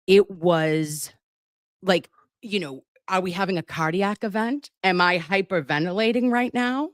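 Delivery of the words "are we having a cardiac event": ordinary delivery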